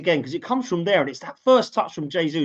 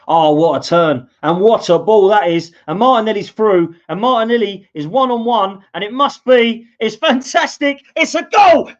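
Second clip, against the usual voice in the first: climbing higher and louder into the goal call; goal commentary build; 6 semitones higher, 9 dB louder than usual. Real, not acted. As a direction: build it with the attack till it bursts.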